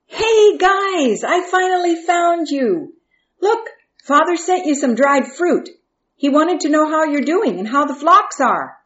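high-pitched, eager little brother; excited greeting. A narrator's reading. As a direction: call out brightly as you finally find them. Young Joseph cheerfully greeting his brothers.

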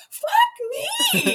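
high, laughing shout; laughing yell. Retold or imitated. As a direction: shriek it out laughing after the shock. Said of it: "she yells".

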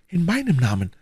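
moved woman's voice, lifted in pitch; deeply moved. A narrator's reading. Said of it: "mit lebhafter Rührung". (bewegt, mit lebhafter Rührung)

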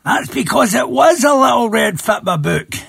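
angry shout. Acted. Angry Mister Fry shouts.

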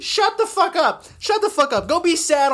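high-pitched, fed-up snap; exasperated outburst. Real, not acted. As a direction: tell them off in exasperation. Ranting back at whiny commenters.

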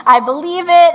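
excited. Retold or imitated. Wife retelling her joy at her husband's new role, fast and excited. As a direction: gush like you just heard great news. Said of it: "I was really happy for him".

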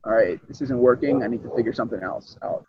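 softly, as if thinking to himself; quiet inner thought. Retold or imitated. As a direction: talk it over quietly to yourself.

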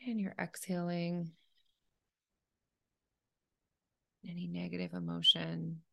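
calm, unhurried meditation leader; guided meditation. Acted. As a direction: gently guide a listener through breathing, leaving space between phrases.

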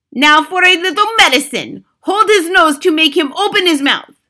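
loud, high put-on witch voice; gloating witch. A narrator's reading. Grand High Witch orders the potion given, loud gloating command. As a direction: call out cruel orders as the witch.

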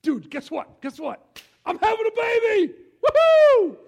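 excited news. Retold or imitated. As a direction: burst out telling a friend about the baby. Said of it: "There's some excitement there".

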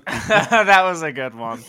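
laughing through the words, slowed. Real, not acted. Reacting to a co-host's wrestling joke, laughing. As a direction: chuckle it out, amused.

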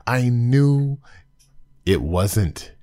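ordinary talk

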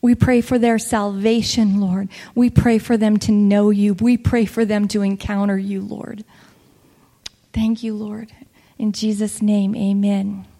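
prayer. Acted. Leads a closing prayer.

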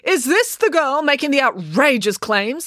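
imperious mayor questioning; mayor demanding of the constable. A narrator's reading. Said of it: "the mayor demanded".

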